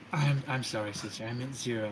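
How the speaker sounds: softening, fading apology; embarrassed correction. Acted. Sister correcting herself, embarrassed fading voice. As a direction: trail off sheepishly.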